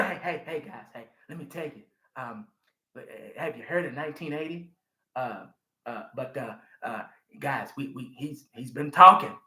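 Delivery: imitating Jerry Jones. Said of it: "I need Jerry Jones to say".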